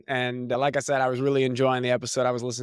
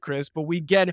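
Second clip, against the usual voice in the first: ordinary delivery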